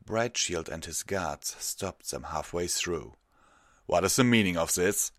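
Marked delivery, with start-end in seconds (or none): 3.9-5.1 loud, stern demand; reproachful. A narrator's reading. Guard captain stopping petitioners in the hall, loud reproach. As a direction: challenge them with raised voice. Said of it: "his reproachful words".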